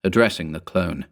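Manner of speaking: ordinary delivery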